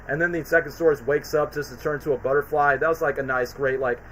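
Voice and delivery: ordinary talk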